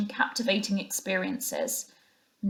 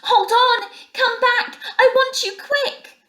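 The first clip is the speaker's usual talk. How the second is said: much higher and louder, eager shouting; small high voice bursting with joy; joyful excitement; 7 dB louder, slower than usual. A narrator's reading. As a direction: shout after him, thrilled and urgent. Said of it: "full of joyful excitement".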